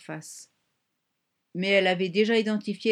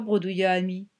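ordinary delivery

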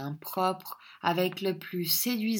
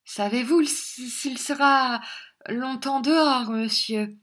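higher, coy and fluttering; coy timid girl; 7 semitones higher, 4 dB louder than usual. A narrator's reading. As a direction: feign shyness in a raised voice. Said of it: "avec une timidité charmante".

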